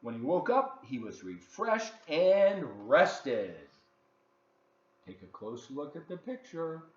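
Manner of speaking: put-on lively read-aloud for children; story narration. A narrator's reading. Host reading a picture book to kids, lively storytelling.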